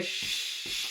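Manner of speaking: firm repeated shushing. Shown as a demonstration. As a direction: shush over a crying baby. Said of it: "So a little bit louder and a bit faster".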